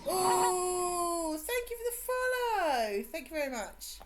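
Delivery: high, loud, drawn-out whoop of gratitude; excited thanks. Real, not acted. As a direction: sing out delight at a new follower. Streamer thanks a new follower.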